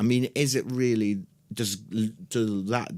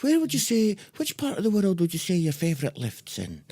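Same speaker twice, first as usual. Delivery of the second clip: high, hammed-up chat show host question; mock interviewer; 7 semitones higher than usual. Podcaster mocking endless lift chat, hammed-up interviewer voice. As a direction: play a gushing interviewer asking about lifts, much higher.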